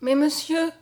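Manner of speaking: high, anxious plea; mildly frightened young girl; trembling. A narrator's reading. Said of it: "dit Mariette toute tremblante".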